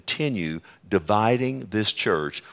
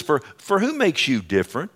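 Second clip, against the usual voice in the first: raised, mocking question; sarcastic; 6 semitones higher than usual. Paul mocking the proud Corinthians, sarcastic. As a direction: needle them with a sneering question. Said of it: "his sarcasm now in verse".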